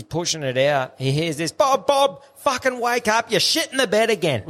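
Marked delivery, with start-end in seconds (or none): 1.5-4.4 high-pitched woman's voice calling his name; woman waking him. Retold or imitated. Wife waking Bob, high-pitched urgent wake-up call. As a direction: jolt him awake in a much higher woman's voice.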